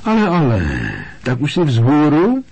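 high, loud, delighted nurse's greeting. A narrator's reading. School nurse greeting a waking patient, loudly delighted. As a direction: beam at the waking boy out loud. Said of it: "zaradovala se hlasitě".

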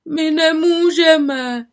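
high, slow whining wail. Acted. Pepek stuck in the tree, wailing. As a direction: moan it pitifully. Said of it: "naříkal Pepek Ševců".

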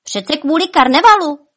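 high, loud exclamation; exclaiming. A narrator's reading. Bonifác answers Špuntík about the carnival, exclaiming. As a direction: call out the obvious answer. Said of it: "zvolal Bonifác".

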